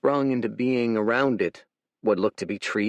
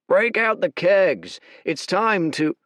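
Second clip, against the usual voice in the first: loud, higher, festive call; cheering soldier; 8 semitones higher, 5 dB louder than usual. Acted. Soldier calls for drinks to celebrate, loud cheer. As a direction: celebrate like the war's won.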